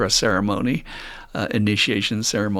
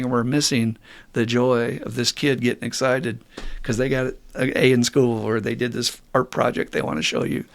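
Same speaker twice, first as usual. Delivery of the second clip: usual speaking voice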